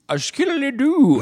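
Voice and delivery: very high, slow squeaky nonsense; goofy high cartoon squeak; silly voice. Silly put-on voice bit.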